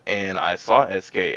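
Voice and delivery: ordinary talk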